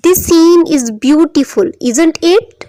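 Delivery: "isn't it" lifts at the end; slow statement, then raise pitch on "isn't it"; rising intonation. Shown as a demonstration. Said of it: "isn't it को हमने सही proper pronounce किया". ("isn't it" पर चढ़ती आवाज़)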